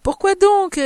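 high, loud, playful question. A narrator's reading. Madame de Lerne replies in the same tone, gaily exclaiming. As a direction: toss the question back gaily. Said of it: "sur le même ton".

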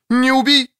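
stops short mid-word, high and loud; cut off mid word. Acted. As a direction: bite the word off halfway. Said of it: "Она осеклась на полуслове". (оборвать слово на полуслове)

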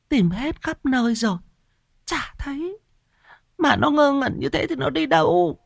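high, tearful whimpering wife; on the edge of tears, high and trembling in pitch. Acted. Wife answers, distraught. (giọng mếu máo, cao)

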